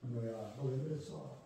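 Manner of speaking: hushed, gentle parent's rebuke; quiet scolding. Pretend. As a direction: lean in and ask it softly. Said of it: "조용히 와가지고".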